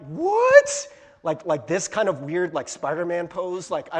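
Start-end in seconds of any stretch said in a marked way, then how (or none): 0.1-0.9 a sudden high, loud bewildered "What?"; shocked what. Retold or imitated. Preacher recoiling at a cheesy couple photo, shocked. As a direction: blurt it out in disbelief. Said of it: "I'm like".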